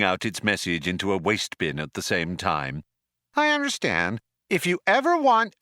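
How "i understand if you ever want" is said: loud, higher, brash ad-read cheer; brash cheery salesman. A narrator's reading. Ship computer pitching help to Zaphod, brash and cheery. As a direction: sell it like detergent.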